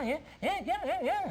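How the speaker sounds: very high chattering monkey voice. Acted. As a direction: squeal it like an excited monkey.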